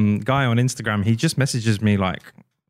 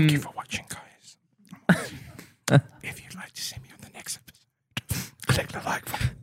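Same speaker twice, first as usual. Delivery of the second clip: very soft whispered sign-off; 3 semitones higher, 8 dB quieter than usual. Pretend. Signing off to imagined podcast viewers, whispered. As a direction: whisper a mock outro into the mic.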